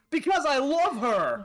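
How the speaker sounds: high, loud, slow mock shriek. A narrator's reading. Reading Draco's line, a hammed-up shriek. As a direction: wail it like a lovesick teen. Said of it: "Draco shrieked".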